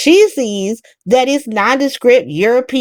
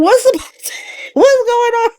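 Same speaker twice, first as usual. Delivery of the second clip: high, alarmed mock panic with a laugh; startled alarm; 13 semitones higher than usual. Watching the warlord about to slap Emma, shocked and high. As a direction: voice jumps up in shocked disbelief. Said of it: "I was like".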